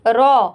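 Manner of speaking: heavy रॉ demo. Shown as a demonstration. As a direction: say रॉ thick and round. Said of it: "हम इसको रॉ पढ़ेंगे".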